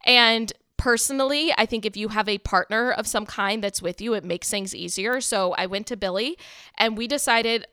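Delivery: normal talking voice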